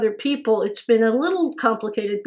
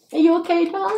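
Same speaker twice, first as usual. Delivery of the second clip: high, cooing check-in; affectionate, high-pitched reassurance; tender concern; 6 semitones higher than usual. Real, not acted. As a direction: coo it gently to a loved one. Comforting partner who nearly cried.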